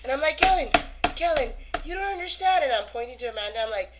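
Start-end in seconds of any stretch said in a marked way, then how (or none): 0.4-2.6 raised, high insistent calling; urgent appeal. Retold or imitated. Friend explaining Amanda to the star, loud urgent appeal. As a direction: plead for his attention loudly.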